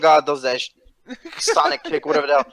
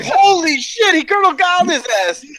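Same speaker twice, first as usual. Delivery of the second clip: high, laughing, amazed outburst; amazed laughing exclaim; 11 semitones higher than usual. Retold or imitated. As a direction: relive the shock with a laugh. Retold amazed reaction, laughing.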